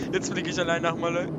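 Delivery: normal talking voice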